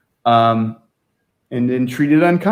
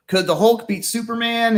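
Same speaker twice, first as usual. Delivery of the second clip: mock kid caller; 8 semitones higher than usual. Mimicking kids' calls.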